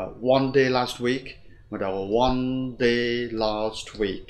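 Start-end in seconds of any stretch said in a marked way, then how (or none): 0.0-1.2 quicker, undrawn reading; the hurried version not to copy; too quick. Shown as a demonstration. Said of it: "chúng ta không đọc là". 2.2-4.2 slow, four evenly stretched words; slow four-beat reading; slightly drawn out. Shown as a demonstration. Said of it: "Hơi kéo dài một tí".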